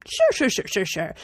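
lightly voiced polite Brit, quick sures; a hint of a polite Englishman agreeing absently; mildly imitating a distracted polite Brit; polite British voice. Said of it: "Very British, very polite".

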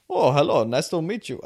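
bad Indian accent impression; lightly put-on Indian accent. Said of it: "horrible Indian accent".

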